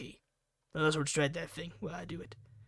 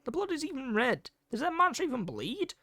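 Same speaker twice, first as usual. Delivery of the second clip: panicked disbelief; 7 semitones higher than usual. Acted. Kenji panics over the body.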